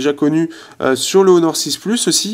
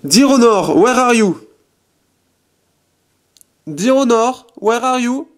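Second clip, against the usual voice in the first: voice command to the phone; 7 semitones higher, slower than usual. Shown as a demonstration. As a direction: call it out clearly so the phone hears. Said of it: "Alors je vais faire". (commande vocale lancée fort et aiguë au téléphone)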